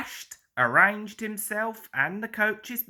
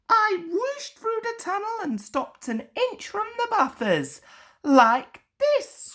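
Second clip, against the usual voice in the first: high, proud engine voice; boasting. A narrator's reading. Thomas brags to Ginty about his race, high proud voice. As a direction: show off, pitched way up. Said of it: "He began boasting".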